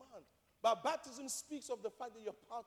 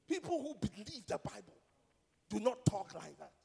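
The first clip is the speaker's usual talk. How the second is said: ordinary talk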